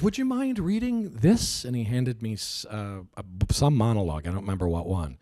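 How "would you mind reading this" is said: higher, slow, quirky director voice; funny manner. Retold or imitated. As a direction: ask it politely, drawn out and oddly. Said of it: "he's got such a funny manner".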